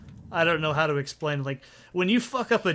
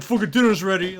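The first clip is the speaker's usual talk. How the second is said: loud, higher-pitched mock holler; imitated rude yell; 4 semitones higher, 7 dB louder, faster than usual. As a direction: bellow it as if banging on the door. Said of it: "walk up and bang on the door".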